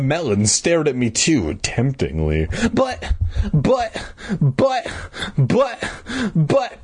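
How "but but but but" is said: high, halting stammer; flustered stutter on one repeated word; sputtering. A narrator's reading. Joe accused in front of everyone, sputtering. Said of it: "I sputtered".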